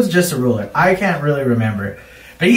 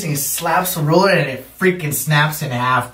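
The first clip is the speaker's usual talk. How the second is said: ordinary talk, nothing marked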